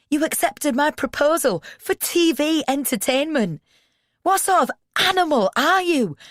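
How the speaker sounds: very high, loud, hurt screaming; anguished shout. A narrator's reading. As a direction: scream it out, wounded and furious. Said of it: "He's spraying angry spittle".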